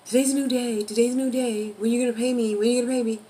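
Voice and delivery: loud, high-pitched repetitive pestering; relentless mock badgering, each line hit hard; mock nagging creditor. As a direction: hound them for money, hammed-up. Repeated nagging of a debtor.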